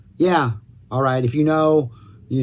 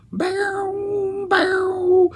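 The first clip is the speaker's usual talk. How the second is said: high, drawn-out "bow" noise; imitated intro sound. Reviewer mimicking Stonehenge's weird little beginning, high imitated sound.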